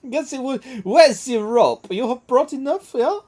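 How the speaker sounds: high-pitched mock French mistress; hammed-up French accent, slowing on the question. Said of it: "like, a French accent".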